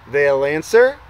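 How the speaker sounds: usual speaking voice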